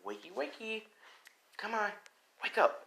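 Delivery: high, singsong wake-up call; female voice. Acted. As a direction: coax a sleeper in a put-on girlish pitch. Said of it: "hears a female voice saying".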